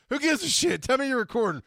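high, loud brush-off; drunken impatient insistence. Retold or imitated. Drunk man brushing off friend's concern, high impatient shout. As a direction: yell it high, urgent to get it on tape.

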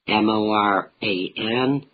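ordinary talk, nothing marked

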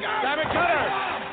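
very high, slow drawn-out call; announcer call. Acted. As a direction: stretch it like a ring announcer.

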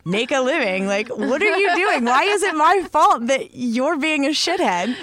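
rising, shouting rant at harassers; angry rant. Retold or imitated. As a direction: build to a furious high shout. Ranting at harassers.

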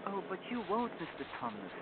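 put-on soft girl's plea, starting high; pleading little Lucy. A narrator's reading. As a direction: plead gently in a child's voice. Lucy pleads not to be betrayed.

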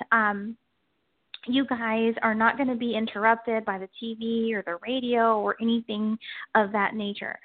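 ordinary talk

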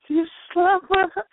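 high, mock-tearful girlfriend voice; imitating a crying girlfriend; tearful accusation. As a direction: play the hurt girlfriend confronting him. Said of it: "She was crying".

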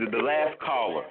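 echoing phone line. Real, not acted. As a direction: voice bouncing back on the line. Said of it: "I sound like an echo".

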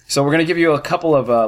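ordinary talk

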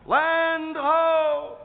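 high, loud call from the mast; yelling land ho. Retold or imitated. Lookout in the mast calling to the crew, loud yell. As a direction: shout it from up the mast. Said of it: "the guy in the mast yells".

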